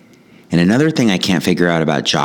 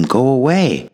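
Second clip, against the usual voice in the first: mock scream back; 9 semitones higher than usual. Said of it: "I scream back at him".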